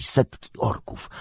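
usual speaking voice

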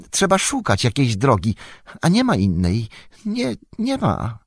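high, whiny creature voice; a frantic, squeaky wretch pleading; Gollum voice. Acted. Gollum answers Faramir.

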